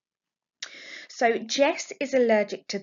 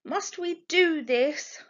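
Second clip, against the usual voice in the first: slow, high pleading whine; whiny protest; 9 semitones higher, 3 dB louder, slower than usual. Acted. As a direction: drag it out like a sulky child. Jess protesting to her mother.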